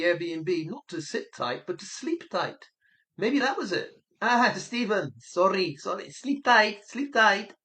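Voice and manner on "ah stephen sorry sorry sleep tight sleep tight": higher, louder put-on apology; apologetic operator. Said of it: "were the Spanish operator telling".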